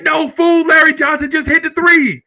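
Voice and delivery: high, loud elated shout; excited shout. Retold or imitated. Dad shouting at another dad about the game's big shot. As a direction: yell it out, still celebrating. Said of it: "And I'm like".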